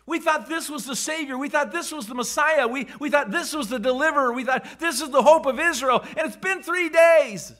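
loud, high, rising despair; anguished disillusionment. Acted. Disciple pouring out his crushed hope, loud and high. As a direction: cry out the lost hope of Israel. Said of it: "Do you hear it in his voice".

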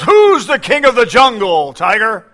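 loud, high roaring challenge; lion's roar. Acted. Proud lion roaring his question at the tiger. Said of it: "And again he roars".

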